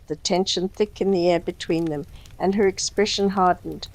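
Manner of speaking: ordinary delivery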